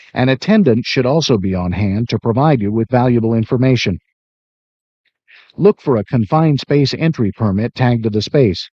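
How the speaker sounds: ordinary talk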